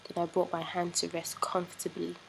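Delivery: ordinary talk, nothing marked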